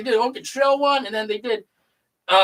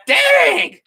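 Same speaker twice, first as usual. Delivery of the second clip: high, loud shout of disbelief; shocked exclaim; 13 semitones higher, 6 dB louder than usual. Retold or imitated. Retelling his reaction to the play, a loud shocked shout. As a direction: blurt it out in shock. Excited exclamation at what he saw.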